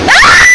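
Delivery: sharp high scream of fright; frightened shriek. Real, not acted. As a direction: shriek as it jumps at you. Frightened by the bird.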